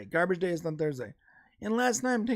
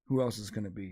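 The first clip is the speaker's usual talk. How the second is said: imitated neighbor, low and quiet; very low, softly needling retort; passive-aggressive neighbor; 12 semitones lower, 5 dB quieter than usual. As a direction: drop the voice into a muted accusation. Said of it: "he does this passive aggressive thing".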